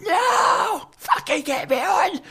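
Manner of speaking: high, desperate yell; furious shout. Retold or imitated. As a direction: yell to be let on stage. Comic demanding to go on stage.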